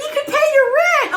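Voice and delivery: high, loud mock-mom squeal; excited mother. Mother cheering daughter's booking, imitated high excited shout. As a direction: shriek it like an overjoyed mom. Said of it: "My mother was excited".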